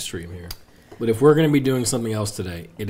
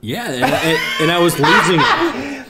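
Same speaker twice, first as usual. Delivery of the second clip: high, slow, laughing out loud; 7 semitones higher, 5 dB louder, slower than usual. Real, not acted. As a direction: crack up while telling it. Laughing at the memory.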